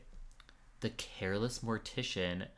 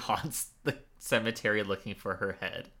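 stifled giggles. Real, not acted. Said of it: "I'm trying not to laugh".

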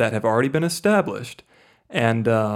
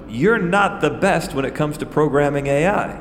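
ordinary talk

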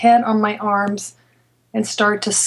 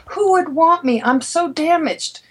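despairing; 6 semitones higher than usual. Retold or imitated. Retold self-doubt to patient.

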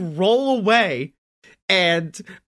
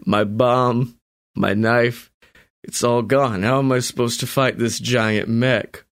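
put-on very old man; 8 semitones lower than usual. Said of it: "He's just like".

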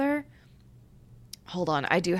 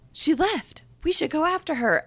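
mock urgent, pitched way up; high, hammed-up alarm; high dramatic character; 10 semitones higher than usual. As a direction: ham up the panicked lovers. Characters' dramatic reaction, voiced.

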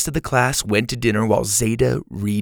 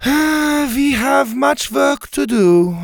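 slow, higher lightly voiced counselor, sighing; weary sigh; 5 dB louder, much slower than usual. A narrator's reading. Helga sighing at tired Zeta, slow and weary. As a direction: let the words sag with a sigh. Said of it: "letting out a long sigh".

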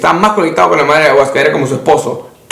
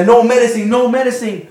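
higher-pitched mock pleading; raised, distressed retelling; imitated frantic protest; 7 semitones higher, slower than usual. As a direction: imitate someone crying out in refusal.

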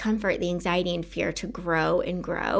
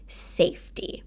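whispered scare quote; 4 dB quieter than usual. Real, not acted. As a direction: whisper "safety" as if in air quotes. Ironic scare quote on "safety.

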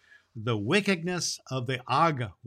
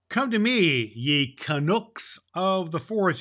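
louder, higher call into the forest; 5 semitones higher, 5 dB louder than usual. Acted. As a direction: summon the forest folk with a raised call. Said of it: "he cried".

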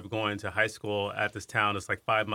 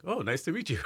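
awed; 7 semitones higher than usual. Retold or imitated. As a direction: play it a touch dazzled and pitched up. Meeting the maestro, awed.

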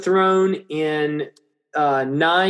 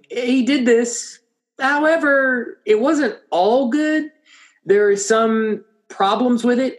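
equivocating; 6 semitones higher than usual. Acted. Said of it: "equivocation in the voice of the author".

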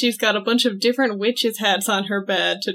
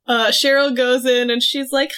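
ordinary talk, nothing marked

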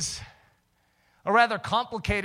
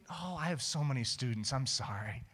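tag apologetic teacher; 3 semitones lower, 6 dB quieter than usual. Retold or imitated. Teacher fails to remember him.

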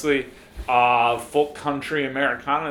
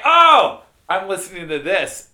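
mock excited; 7 semitones higher, 9 dB louder than usual. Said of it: "and then you were like".

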